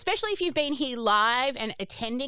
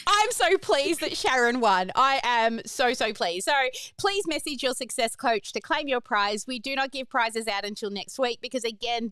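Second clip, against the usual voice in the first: ordinary talk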